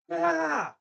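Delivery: high, loud yell-leader "ah"; imitated yell. Host imitating A and M yell leaders, mock yelling.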